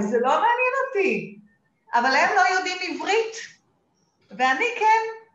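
גבוה ורם, בהתלהבות; בגאווה נלהבת, בקול רם. Real, not acted. Proud retort about knowing Hebrew. (מתלהבת וגאה)

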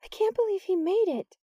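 very high, incredulous exclamation; astonished cry. A narrator's reading. Sarah at the window after his escape, amazed cry. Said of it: "Sarah cried".

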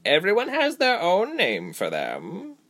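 high, slow, swooping; unhurried creature voice, drawn-out words; female creature. A narrator's reading. Woman creature explaining to the captives, deliberate.

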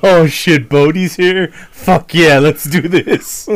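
loud, high, thrilled outburst; excited fanboy cheer. Retold or imitated. Fan cheering at the movie, loud and thrilled. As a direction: cheer like Bodhi just walked in. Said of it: "I'd just be like".

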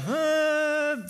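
very high, drawn-out silly "huh"; goofy high-pitched hum of effort; careless archer's noise. Retold or imitated. Archer lets an arrow fly at random, goofy high noise. Imitates archer shooting without aiming.

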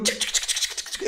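whispered tchic tchic shooting noises; quiet mouth sounds of a toy gun; soft gunshot sounds. Retold or imitated. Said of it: "ficar dando tirinho assim".

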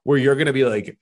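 usual speaking voice

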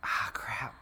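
under-breath whisper of annoyance; whispered frustration. Real, not acted. Host blanking on Mandarin, whispered self-annoyance. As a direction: mutter it to yourself after blanking. Forgets Mandarin words mid-try.

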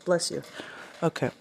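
ordinary talk, nothing marked

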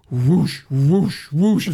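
imitated whoosh, building in force; forceful whooshes closing in. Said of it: "it just gets louder and louder".

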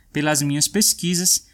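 ordinary talk, nothing marked

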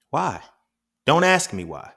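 high, loud mock indignation; acted parental quarrel. Parent refusing a child's question, acted indignant retort. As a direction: snap back like an exasperated parent, hammed up.

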